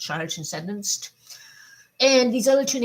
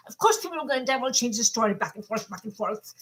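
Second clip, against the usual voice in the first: ordinary delivery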